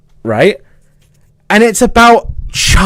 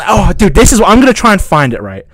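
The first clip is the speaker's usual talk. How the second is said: usual speaking voice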